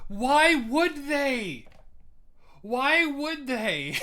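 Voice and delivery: sarcastic, slowed-down retort; mock incredulous. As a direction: drag it out like it's obvious. Mock reply to the video's argument.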